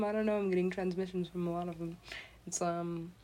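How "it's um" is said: usual speaking voice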